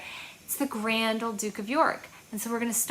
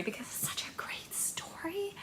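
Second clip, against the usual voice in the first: whisper; 9 semitones higher, 11 dB quieter than usual. Real, not acted. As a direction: share it in a happy whisper. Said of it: "whispered aside".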